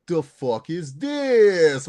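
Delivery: slow, drawn-out "The fuck is this?"; coworker imitating catchphrase. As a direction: stretch "The fuck is this?" in mock annoyance. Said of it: "my coworkers will now go".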